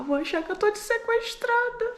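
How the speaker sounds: high-pitched plea building to a loud shout; panicked "stop!", shouted in retelling; mock frantic yell. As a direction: act out wanting to scream at the child. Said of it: "Minha vontade era de gritar com ela".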